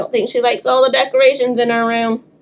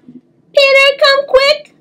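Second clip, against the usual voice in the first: shouting; 4 dB louder, much slower than usual. Acted. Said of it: "I shouted".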